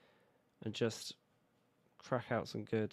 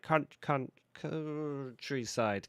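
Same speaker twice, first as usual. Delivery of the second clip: put-on failed West Country accent; 5 semitones higher, 3 dB louder, much slower than usual. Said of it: "I was trying to do it in a West Country accent".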